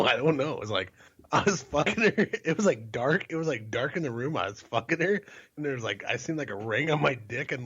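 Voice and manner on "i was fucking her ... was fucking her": rising excitement; telling it all worked up; excited, high. Real, not acted. Excited retelling, voice up.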